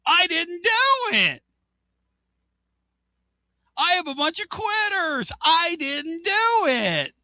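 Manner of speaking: mocking the coach's Basilio imitation. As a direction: ham up a coach dodging the blame. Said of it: "she was doing her Basilio imitation".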